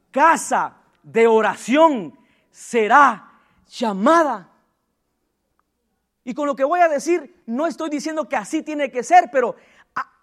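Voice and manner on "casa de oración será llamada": "casa de oración" read slowly and stretched; slow scripture. Real, not acted. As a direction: intone the verse slowly. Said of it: "diga conmigo". (cita dicha lenta y solemne)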